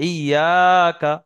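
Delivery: slow, loud word with a long held aa; Quran recitation. Shown as a demonstration. Teacher lifts one word from the verse, loud drawn-out recitation. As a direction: stretch the middle vowel strongly, recitation style.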